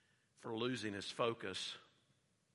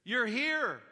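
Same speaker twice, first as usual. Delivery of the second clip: higher and louder, slow awed recognition; mock excited Peter; 12 semitones higher, 8 dB louder, slower than usual. Peter greeting Jesus on the water, awed and excited. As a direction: Peter realising it's the Lord. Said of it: "It's like".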